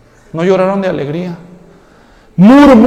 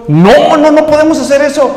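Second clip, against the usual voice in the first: urgent protest; 6 semitones higher than usual. Acted. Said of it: "Josué y Caleb van a decir".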